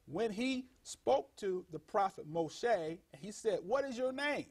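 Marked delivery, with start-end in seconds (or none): 3.6-4.5 quoted question. Retold or imitated. Voices Moshe's question in scripture.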